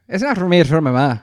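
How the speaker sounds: put-on loud Irish Traveller accent; Mickey from Snatch. Podcaster quoting a film character, loud Irish accent. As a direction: burst out in a thick Irish accent. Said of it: "would be Mickey from Snatch".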